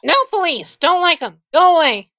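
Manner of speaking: high-pitched, short refusals from a grumpy character; grumpy shut-in. Acted. Resident refusing to talk to investigators at the door, grumpy high voice. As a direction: send them packing in a high voice. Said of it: "you hear someone say".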